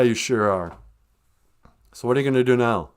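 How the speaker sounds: ordinary talk, nothing marked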